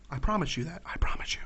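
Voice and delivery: intimate whispered promise. Real, not acted. Gamer whispers a promise to his viewers. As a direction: breathe it close to the mic.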